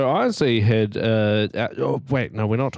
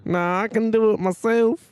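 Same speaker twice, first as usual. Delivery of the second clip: high, whiny put-on Australian; mock Australian accent; 11 semitones higher than usual. Showing off without blocking nose, mock Australian accent. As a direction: pitch it way up in a broad Aussie twang. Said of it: "Makes you sound Australian".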